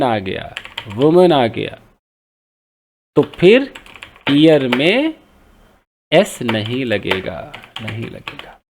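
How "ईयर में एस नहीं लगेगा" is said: normal talking voice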